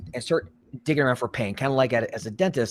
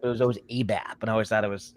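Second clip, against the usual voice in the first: ordinary delivery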